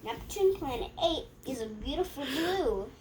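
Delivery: normal talking voice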